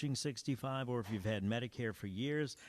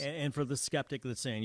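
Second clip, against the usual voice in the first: ordinary delivery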